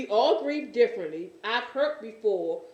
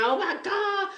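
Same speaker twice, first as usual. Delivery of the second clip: high, wailing imitation of a mourner; imitated crying; 10 semitones higher than usual. Imitating a grieving person on the other line, crying out. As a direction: cry out in mock grief, pitched way up. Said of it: "people pouring out crying".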